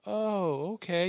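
a high, startled "oh, okay" in a mock reaction; taken-aback surprise. The termite guy seeing the wall of mounts, a high, mildly startled retold reaction. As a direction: imitate a guy caught off guard and a bit uneasy.

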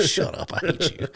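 high-pitched, laughing mock complaint; mock envy. Friends razzing the lucky hunter, laughing mock envy.

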